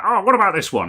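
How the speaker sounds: high, loud mimicked suggestion; eager chatters. Host voicing people in chat suggesting boards, eager. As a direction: pipe up like an eager viewer.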